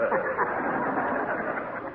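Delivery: breathy nervous laugh; host laughing off a jab; embarrassed chuckle. Acted. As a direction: laugh it off uneasily. Gildersleeve covering embarrassment.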